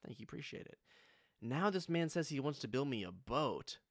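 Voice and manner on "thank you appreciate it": muttered thanks. Retold or imitated. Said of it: "I said".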